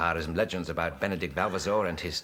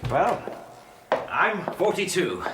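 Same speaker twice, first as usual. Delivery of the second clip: sheepish confession; 9 semitones higher, slower than usual. Acted. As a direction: own up to your age with a flourish. Visitor reciprocates, bashfully confessing his age.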